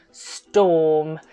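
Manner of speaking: usual speaking voice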